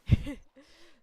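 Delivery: sudden loud high laugh. Real, not acted. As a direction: burst out laughing. Laughs at own indecision.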